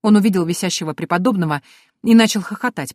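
usual speaking voice

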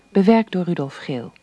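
usual speaking voice

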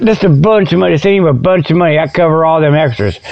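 ordinary talk, nothing marked

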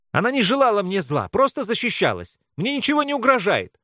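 urgent high-pitched rush; blurted out. A narrator's reading. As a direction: blurt it out to stop him. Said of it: "Быстро, почти скороговоркой, выпалила Белка". (выпалить скороговоркой)

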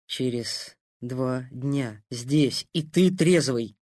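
Через два дня slow and firm; firm emphasis. Acted. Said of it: "с нажимом повторил Трофимов".

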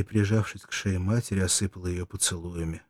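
ordinary talk

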